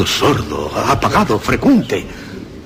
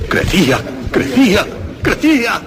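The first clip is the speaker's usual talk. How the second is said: each crecía climbing higher, building from calm to frantic; rising frenzy; 10 semitones higher than usual. Acted. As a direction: let panic mount with every repeat. Ruido crece, narrador desesperado.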